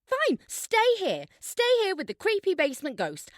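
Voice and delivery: high, loud furious outburst; angry shout. Acted. Friend to a stubborn girl who refuses to leave, shouting angrily. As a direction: snap at her, fed up.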